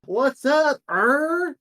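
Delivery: high, loud goofy greeting; a high, loud cartoonish dog greeting; Bill's voice. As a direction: pitch way up for a dopey creature hello. GM voices Bill entering shop.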